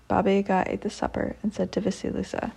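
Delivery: usual speaking voice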